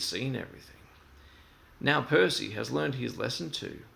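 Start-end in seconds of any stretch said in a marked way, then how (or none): none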